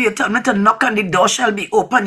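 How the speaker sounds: normal talking voice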